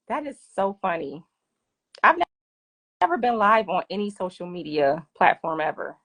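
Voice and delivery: usual speaking voice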